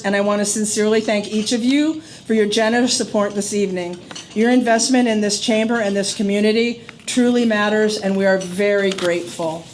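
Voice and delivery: usual speaking voice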